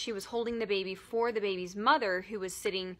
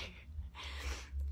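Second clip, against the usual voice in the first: breathy laugh; 11 dB quieter than usual. Real, not acted. Embarrassed laugh.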